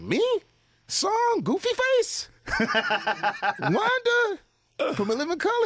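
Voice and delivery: squeaky incredulous shock; high disbelief. Retold or imitated. To [name], incredulous high-pitched squeal of surprise. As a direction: ask it in high, laughing disbelief.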